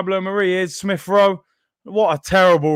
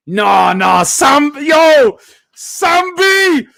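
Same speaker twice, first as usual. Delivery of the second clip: louder and higher, calling his name out; excited shout; 8 semitones higher, 7 dB louder, much slower than usual. Real, not acted. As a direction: shout for the player in delight. Calling out to the player.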